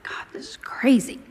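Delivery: hushed whisper to God; whispered disbelief; whispered prayer. Retold or imitated. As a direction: breathe it out like a private prayer. Praying in disbelief, quoted thought.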